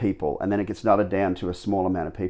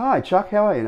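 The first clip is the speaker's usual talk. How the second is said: high, bright-eyed hello, much higher; eager greeting; 11 semitones higher, 4 dB louder than usual. Retold or imitated. Answering the trainer's call, a high eager hello. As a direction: greet the caller with eager lift.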